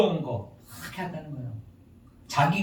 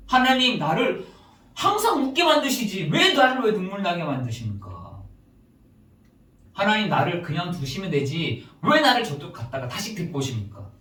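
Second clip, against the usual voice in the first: raised-voice appeal, easing toward the end; pleading complaint; 3 semitones higher than usual. Acted. Solomon complains to God about his tears, pleading complaint. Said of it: "막 이렇게 하소연합니다".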